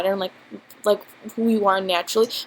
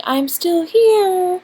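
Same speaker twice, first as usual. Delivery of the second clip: strange voice; 9 semitones higher, 6 dB louder, much slower than usual. Retold or imitated. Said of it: "you can literally hear a change in my voice".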